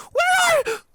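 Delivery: high frantic cry; panicked shout. Acted. Twilight calling for missing Spike, panicked. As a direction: call out in rising panic. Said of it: "further unnerved by the lack of an answer".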